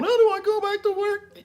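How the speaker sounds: high whiny mock wail; mocking whiny liberal. Streamer mocks upset voters with a high whiny wail. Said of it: "lefties that's freaking out and crying".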